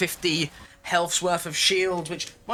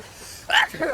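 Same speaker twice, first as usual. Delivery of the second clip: sudden loud sneeze into the mic; a real unplanned sneeze; sneezing; 12 semitones higher, 3 dB louder than usual. Real, not acted. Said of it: "my apologies for the sneeze".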